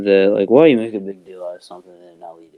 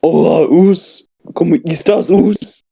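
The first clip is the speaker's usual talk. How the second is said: a hammed-up Kalisto greeting; exaggerated Spanish-accent hello; mock Spanish greeting; 8 semitones higher than usual.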